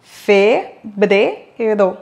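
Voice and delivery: Febrero split slowly into Fe-bre-ro; syllable by syllable. Shown as a demonstration. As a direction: break Febrero into three slow syllables. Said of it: "en le disant à voix haute".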